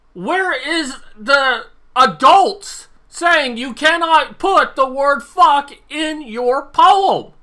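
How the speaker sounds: slow, high, loud and indignant; exasperated demand. Real, not acted. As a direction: hammer out each word in outrage. Demands why no adult stepped in.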